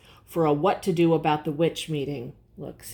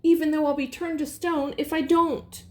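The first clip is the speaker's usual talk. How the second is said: pleading character voice; 9 semitones higher than usual. Acted.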